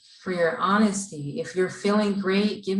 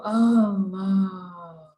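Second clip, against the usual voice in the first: crying out on exhale; 3 dB louder than usual. Shown as a demonstration. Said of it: "Let's try that one more time".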